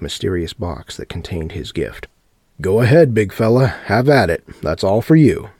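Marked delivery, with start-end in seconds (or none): 2.6-5.5 loud, high gloating showman; gloating host voice. A narrator's reading. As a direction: egg him on like a showman. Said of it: "Sebastian said".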